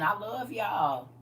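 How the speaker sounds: slow, tearful, voice catching; choked up. Real, not acted. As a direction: say it while holding back real tears. Moved by viewers' tears.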